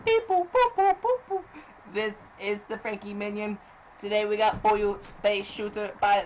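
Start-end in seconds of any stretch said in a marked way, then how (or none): 0.0-1.4 very high beeping alien chatter. Acted. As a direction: beep and boop like a little alien. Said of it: "that's my alien voice".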